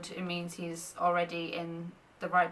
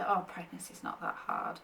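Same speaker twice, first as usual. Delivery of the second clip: dismissive people; 8 dB quieter than usual. Retold or imitated. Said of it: "some people might say".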